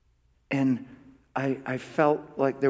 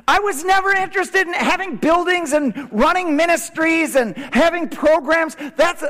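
high, loud, full-force preacher's cry; impassioned preaching; 13 semitones higher, 9 dB louder than usual. Real, not acted. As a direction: preach it with raised voice and burning conviction.

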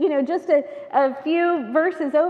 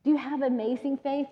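ordinary talk

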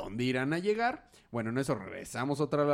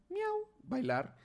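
playful cat meow; much slower than usual. Pretend. Catwoman cat-flirts at thug.

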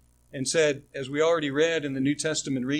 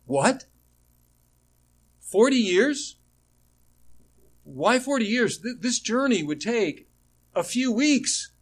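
high-pitched, astonished disbelief; incredulous questioning; 7 semitones higher than usual. Acted. Teacher voicing the listeners' reaction to forty years, incredulous. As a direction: question it in rising amazement, pitched well up. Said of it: "we should be saying".

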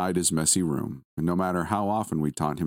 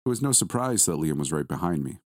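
usual speaking voice

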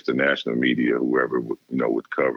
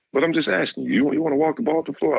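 much higher mock coach voice, needling; imitating the coach, scoffing; faster than usual. Coach mocking a rival school to a recruit, high mock voice. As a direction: play the coach teasing the recruit, pitched way up. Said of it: "He's like".

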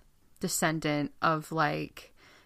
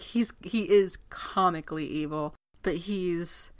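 ordinary delivery

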